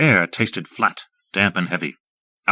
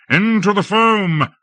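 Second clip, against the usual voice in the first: high, loud, slow charge cry; rally the men forward; war cry into the foam; battle cry; 10 semitones higher, 4 dB louder than usual. A narrator's reading. Lord leads the charge.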